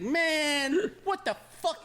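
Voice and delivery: shocked rambling clerk; incredulous old guy. Retold or imitated. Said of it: "He's like".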